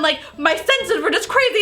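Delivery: ordinary delivery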